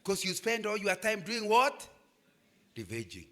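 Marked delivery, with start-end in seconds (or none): none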